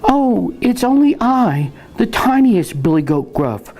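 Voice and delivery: high-pitched tiniest goat; very small voice. A narrator's reading. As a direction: squeak small and innocent, like a little goat. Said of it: "in his very small voice".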